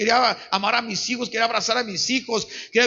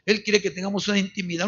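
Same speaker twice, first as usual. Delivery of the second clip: normal talking voice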